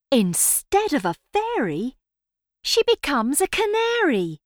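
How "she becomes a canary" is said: usual speaking voice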